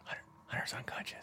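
whispered stage aside. Pretend. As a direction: hush it like a secret.

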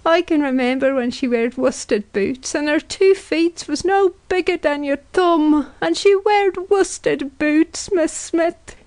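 high wailing dirge, climbing as it goes; keen it like a funeral dirge; grief-stricken mother's high lament; high, strained crying. A narrator's reading. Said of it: "crying out in a high, strained voice".

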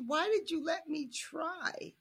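high, slow mock whine; whiny plea. Tarisai whining to Mangwe in a high, slow, mock-hurt voice. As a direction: plead like a wounded kid.

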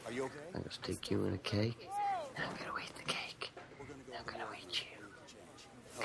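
soft, menacing mock whisper; hushed, playful menace; creepy whisper. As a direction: whisper it like a comic threat. Mock-menacing echo of the line.

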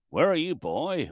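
slow mock Texan "Where are you, boy?"; Texan drawl. Ham radio operator from Texas asks, in mock Texan accent. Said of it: "he must have clocked my accent".

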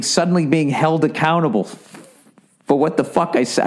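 normal talking voice

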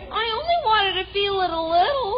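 high, quick, hurt whine; whiny complaint. Acted. Child told to stop touching it, whiny. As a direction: plead tearfully after being scolded.

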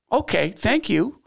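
slow, high, falsely polite thanks; fake sweetness. Boss to admin before confronting union guy, slow high fake politeness. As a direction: thank her far too sweetly.

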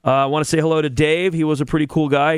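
ordinary delivery

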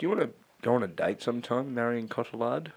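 smooth low voice. Acted. Asking Marion on a date.

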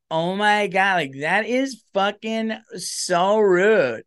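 put-on high, slow, scandalized valley girl; mock-gasp at rudeness, drawn out and high; offended woman. Woman president acting offended, high and scandalized. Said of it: "you can't have a woman like".

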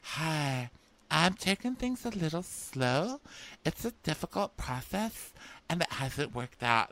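high, mock-earnest voice; imitated mom. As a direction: imitate an overwrought contestant opening up. Voicing the mom's halting confession.

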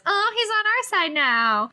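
very high mock-Hermione reassurance; high-pitched know-it-all imitation. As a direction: pitch way up, prim and matter-of-fact. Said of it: "Hermione says".

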